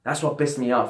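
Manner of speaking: normal talking voice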